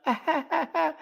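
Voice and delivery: mock high fake laugh; high put-on giggle. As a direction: imitate a forced giggle. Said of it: "look at the fake laugh".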